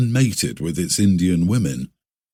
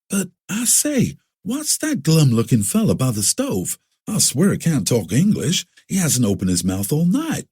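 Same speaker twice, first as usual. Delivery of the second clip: put-on curious young Prince; 7 semitones higher than usual. A narrator's reading. Prince asks about the stranger.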